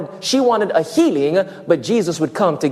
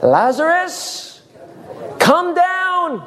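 mock call upward; 11 semitones higher, much slower than usual. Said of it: "He said three words".

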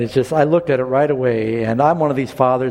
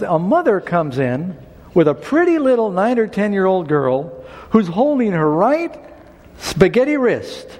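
ordinary talk

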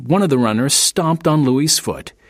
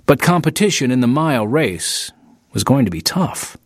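plain reading voice

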